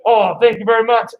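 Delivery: normal talking voice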